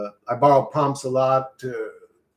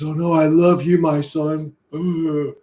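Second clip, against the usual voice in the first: slightly raised mock-loving voice; lightly voiced God; 3 semitones higher, 6 dB louder, slower than usual. Cartoon God speaking to his son, mock loving voice. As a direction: play a lightly hammed-up doting deity.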